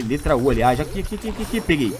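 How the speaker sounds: normal talking voice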